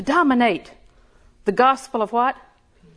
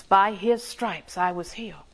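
slow, softer, reverent reading; reciting Scripture; 3 semitones lower, 4 dB quieter than usual. Shown as a demonstration. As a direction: lay the verse down slowly like a quiet prayer. Quoting the Scripture promise.

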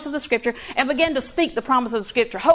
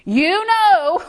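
mocking a friend; 8 semitones higher, 5 dB louder than usual. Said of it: "somebody in your ear saying".

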